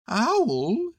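high bear voice, mildly grave; solemn Pooh. A narrator's reading. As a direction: address him earnestly in a high voice. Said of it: "said Pooh solemnly".

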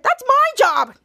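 high, loud retold objection; indignant protest. Henry objects to Charlotte taking his job, indignant.

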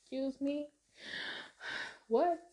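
high, soft, disbelieving questions; acted shock. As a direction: gasp it out in hushed disbelief. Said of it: "I was shocked".

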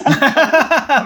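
loud high laugh; burst of laughter. Real, not acted. Reacting to confession of pet story.

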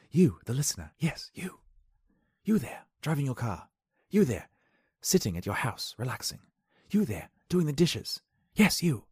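playful address. Real, not acted.

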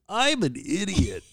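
high, slow, drawn-out catchphrase; exaggerated high self-mocking one-liner; catchphrase drop. Shown as a demonstration. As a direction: stretch it out high for the sound drop. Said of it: "We gotta use that drop".

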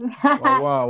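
high Jamaican patois greeting; playful put-on Jamaican accent; mock Jamaican. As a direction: toss out a mock Jamaican hail.

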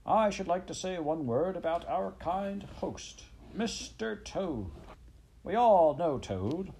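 high, slow mock-grand announcement; squeaky speechmaker. A narrator's reading. Chief Weasel mocking his host Toad, high and squeaky. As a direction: draw out the host's name in a high voice. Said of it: "began in a high, squeaky voice".

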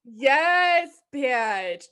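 high, loud hype. Real, not acted. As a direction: cheer her on. Hyping friend.

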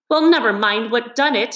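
in pain. A narrator's reading. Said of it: "forgetting his grammar in his pain".

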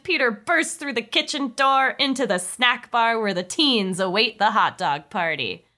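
ordinary talk

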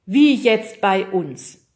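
loud, slow, drawn-out punchline; dramatic. Acted. Weatherman compares it with today, dramatic. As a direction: let each word land heavily.

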